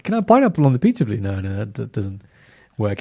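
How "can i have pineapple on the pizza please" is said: high, fast mock tourist plea; mock tourist request. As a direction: ask it like a clueless tourist. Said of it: "you can't ask".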